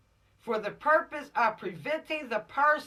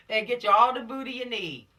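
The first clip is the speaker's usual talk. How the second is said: normal talking voice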